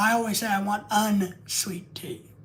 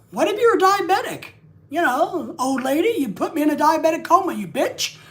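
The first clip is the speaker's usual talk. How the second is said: higher and louder, sputtering comeback; mock indignant retort; 6 semitones higher, 5 dB louder than usual. Streamer firing back at the old lady, mock indignant and loud.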